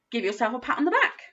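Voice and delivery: ordinary talk, nothing marked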